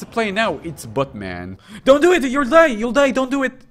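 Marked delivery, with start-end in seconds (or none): 1.8-3.6 high, loud panicked yell. Acted. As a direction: scream a warning then scold. Yelling at character on screen.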